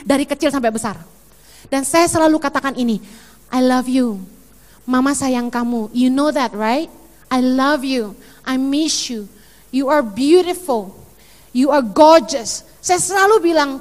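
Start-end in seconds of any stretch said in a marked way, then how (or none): 3.5-12.7 tender. Retold or imitated. Mother's tender words to children.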